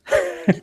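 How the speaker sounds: high-pitched real laugh; a quick burst of high laughter; short laugh. Real, not acted. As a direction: laugh at the mess. Laughing at the mess.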